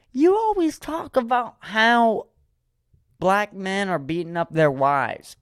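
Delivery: high-pitched, drawn-out accusing cousin; cousin imitation. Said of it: "this is my imitation of my second cousin".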